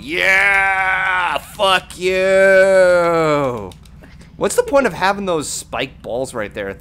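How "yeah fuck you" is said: long-held, shouted words; triumphant shout. Real, not acted. Player yelling at the game boss, triumphant drawn-out shout. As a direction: crow at the beaten enemy, stretching every word.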